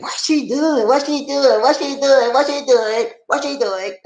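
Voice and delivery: high repeated bewildered question; high mock disbelief; baffled onlooker. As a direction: act the crowd staring in disbelief. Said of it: "y'all gonna be like".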